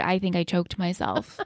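hoarse, quiet, rough voice; raspy voice. Real, not acted. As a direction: speak through a sore throat. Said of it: "I've been this raspy voice".

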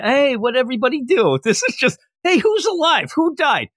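high, bright-pitched back-to-school greeting; mock cheery classmate. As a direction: ask it like a chipper classmate after break.

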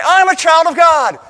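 high, loud fervent shout; bursting declaration. Acted. Believer longing to declare identity in God, bursting shout. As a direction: shout out what's been held inside. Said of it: "you just wanna say".

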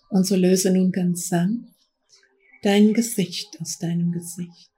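guided meditation. Acted. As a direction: guide them gently into trance. Leads a guided trance journey.

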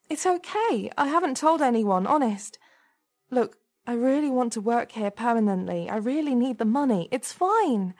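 high, nervous woman's voice; worried, high-voiced reassurance; anxious pleading. A narrator's reading. Temp pleading for her job.

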